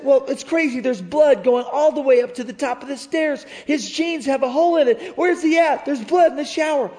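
high, loud panicked rush; frantic mom. Retold or imitated. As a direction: blurt it out in alarm. Mom panicking about blood.

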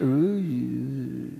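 long, low, soft "ooh" fading away; imitated power-down drone. Preacher mimicking the barkers going silent, low dying drone. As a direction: imitate sound draining out as the plug is slowly pulled. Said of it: "slowly pulling the, they just went".

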